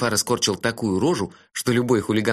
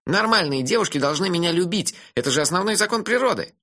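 put-on cheeky dreamy young man, higher; teasing friend, pitched up; higher voice for Melifaro; 6 semitones higher than usual. (насмешливый голос Мелифары повыше)

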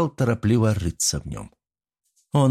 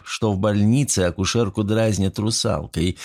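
plain reading voice

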